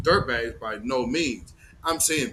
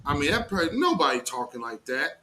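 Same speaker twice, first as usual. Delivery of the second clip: normal talking voice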